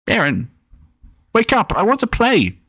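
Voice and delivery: put-on a high, squeaky kid begging to play; squeaky little brother. A narrator's reading. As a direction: pipe it up like an eager little boy. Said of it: "higher-pitched, squeaky in a way, like a mouse".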